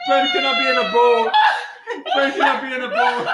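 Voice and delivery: high shout, laughing at the end. Retold or imitated. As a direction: yell it out, half laughing. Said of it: "He goes".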